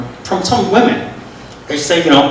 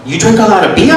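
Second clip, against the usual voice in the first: put-on higher Vietnamese accent, rising question; mock Vietnamese accent; imitated Vietnamese accent; 5 semitones higher, faster than usual. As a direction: mimic a nosy Vietnamese coworker asking. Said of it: "have to excuse my accent".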